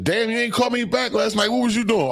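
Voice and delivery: high, nagging mimicry of a girlfriend; mock woman's voice. Mimicking a girlfriend demanding where he was last night, high voice. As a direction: pitch it way up like a suspicious girlfriend.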